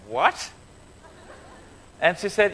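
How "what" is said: retold puzzled "what", swooping sharply upward; short questioning yelp of disbelief, acted; mock baffled. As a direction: play the young men's bewilderment lightly. Young men baffled by her invitation.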